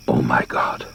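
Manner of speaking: ordinary delivery, nothing marked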